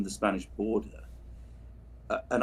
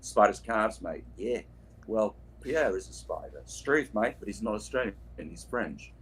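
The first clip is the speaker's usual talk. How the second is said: normal talking voice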